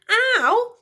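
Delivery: high sharp ow; little yelp of pain; boy's yelp. Acted. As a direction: yelp like a bumped boy. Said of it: "complained the boy".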